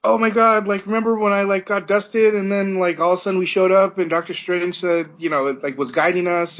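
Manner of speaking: put-on blurting it out like an overjoyed teen; excited Peter. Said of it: "he's like".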